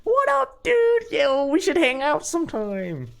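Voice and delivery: high-pitched, drawn-out starstruck fan squeal; gushing fanboy. Pretend. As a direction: gush like an overexcited fan meeting his idol. Imagined gushing fan he avoided being.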